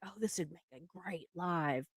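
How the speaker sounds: very soft, as if thinking to oneself; inner thought. Retold or imitated. Streamer gets an idea in the shower, hushed thought to herself. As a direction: drop right down for a private musing. Voicing her own private thought.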